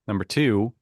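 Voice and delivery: normal talking voice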